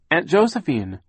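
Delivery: high, loud searching call, falling at the end. A narrator's reading. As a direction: call out for a missing aunt. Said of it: "Violet called again".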